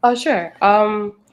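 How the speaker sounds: ordinary talk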